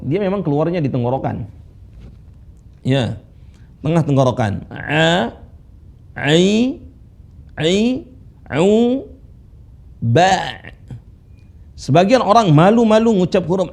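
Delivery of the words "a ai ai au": A, ai, au from the middle of the throat; deep throat ain. Shown as a demonstration. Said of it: "Cara bacanya pakai suara dalam". (ain dibaca dari tengah tenggorokan)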